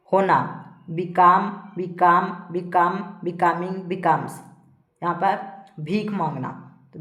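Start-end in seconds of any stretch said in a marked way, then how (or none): 0.9-4.4 evenly spaced list, firm first word; verb drill recitation. Shown as a demonstration. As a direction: recite each form separately for pupils to memorise. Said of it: "पढ़ पढ़ के आप लोग को बताएंगे".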